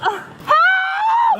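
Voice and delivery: high sobbing shout for help; crying scream. Real, not acted. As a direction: wail it, in tears. Handcuffed woman screams crying.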